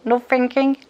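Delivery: put-on punchy, playful take on an accented master; maître Jin's accent. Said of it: "il disait ça avec son superbe accent". (imitation de l'accent du maître)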